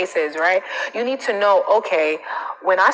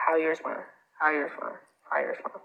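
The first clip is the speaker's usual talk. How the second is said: quieter each time, dying away; fading to a murmur; 3 semitones lower, 9 dB quieter, faster than usual. Shown as a demonstration. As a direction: repeat the mantra, softer and softer. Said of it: "I say it to myself three times in my head".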